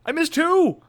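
high-pitched put-upon whine; a kid pleading it was only two wrong; exasperated kid. Retold or imitated.

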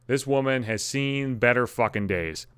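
usual speaking voice

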